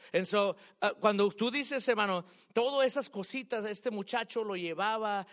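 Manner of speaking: excited preaching. Real, not acted. Preacher fires up recalling mentors.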